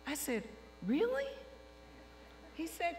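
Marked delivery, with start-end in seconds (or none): 0.9-1.3 high squeak of disbelief; stunned high "Really?"; surprised. Retold or imitated. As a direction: shoot the pitch way up in shock. Surprised at job offer.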